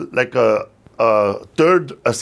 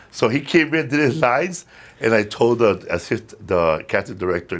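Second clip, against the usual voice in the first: ordinary delivery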